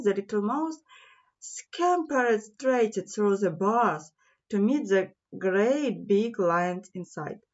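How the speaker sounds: ordinary delivery, nothing marked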